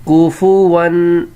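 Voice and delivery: کفوون drawn out, ku-fu-wun, ending in a clear wun; slow model reading. Shown as a demonstration. Teacher to pupils, slow word with tanween. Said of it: "تو ون کی sound آئے گی".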